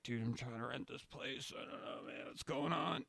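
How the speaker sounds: very soft, half-whispered excuse; whispered dazed answer to the stranger; mumbled stoned reply. Retold or imitated. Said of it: "I was just like".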